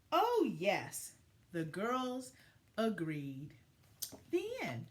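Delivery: gentle, expressive picture-book narration; reading to children. A narrator's reading. Teacher reading a story to her class, storybook voice. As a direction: tell it like story time for young kids.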